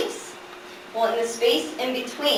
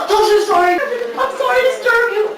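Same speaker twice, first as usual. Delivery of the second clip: high, loud comic panic; panicked apology; 14 semitones higher, 8 dB louder than usual. Retold or imitated. Apologizing to the disturbed ashes, panicked. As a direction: blurt it out in a mock-horrified squeal.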